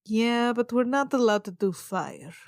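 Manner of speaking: normal talking voice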